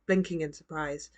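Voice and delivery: usual speaking voice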